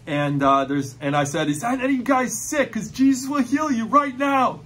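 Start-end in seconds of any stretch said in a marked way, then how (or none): 1.5-4.6 fervent high call to the crowd; fired up in faith. Retold or imitated. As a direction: proclaim healing with zeal. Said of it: "I was just so fired up in faith".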